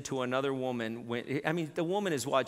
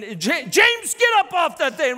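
very high, loud shout; shouted order; 12 semitones higher, 10 dB louder than usual. Retold or imitated. Wife yelling at husband to get off the couch. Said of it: "and then".

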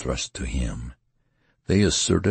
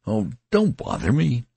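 exasperated cry; 10 semitones higher, 4 dB louder than usual. A narrator's reading. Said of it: "gave a cry of exasperation".

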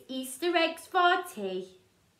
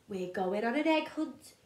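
usual speaking voice

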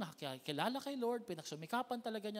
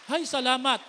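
loud high cry of relief; relieved exclamation; 6 semitones higher, 15 dB louder than usual. Acted. Lazarus sighing with relief at last, loud and high. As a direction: burst out in relief.

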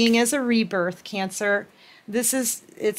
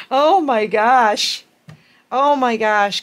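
louder, slow awed oh my gosh; excited gasp; 2 semitones higher, 5 dB louder, slower than usual. Real, not acted. Reader reacting to the cards, awed and excited. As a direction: marvel aloud. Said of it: "I'm all excited".

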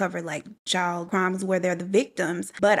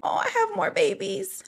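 high squeaky delight over tiny tomatoes; delighted squeal; 12 semitones higher than usual. Retold or imitated. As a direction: squeal it like you found treasure. Delighted self-quote, falsetto.

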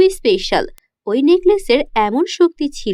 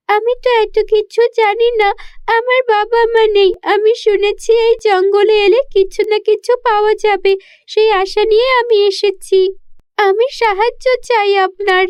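put-on a lost child asking for help, pitched up high; little girl voice; 7 semitones higher than usual. Orphan girl pleads to fairy. (ছোট্ট মেয়ের উঁচু গলায় অনুনয়)

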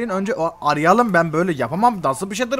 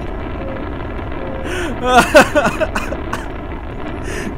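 launch laugh; 6 semitones higher than usual. Pretend. Rocket launch, screaming laugh.

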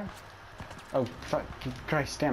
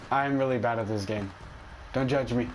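usual speaking voice

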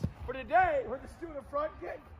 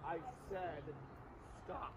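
quiet say; 9 semitones lower, 14 dB quieter than usual. Shown as a demonstration.

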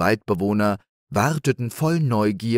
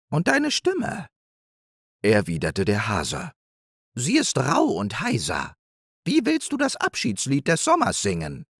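higher, mocking hare voice; scornful hare; 5 semitones higher than usual. Hare ridiculing the hedgehog's wish, lightly scornful. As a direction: scoff at the idea.

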